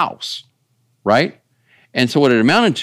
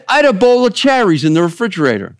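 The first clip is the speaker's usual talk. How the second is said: put-on higher, fast Italian-accented quote; 6 semitones higher, faster than usual. Pastor retelling a comedian's story, Italian accent. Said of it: "I'm trying to do my Italian accent".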